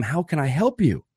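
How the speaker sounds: match their urgency as you ask; keen, urgent helper; excited and urgent. Shown as a demonstration. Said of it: "very excited and urgent at the same time as they are".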